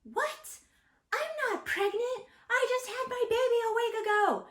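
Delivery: high-pitched, loud indignant outburst; pissed-off woman. Retold or imitated. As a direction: imitate an offended mother, voice shooting way up. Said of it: "She got so pissed".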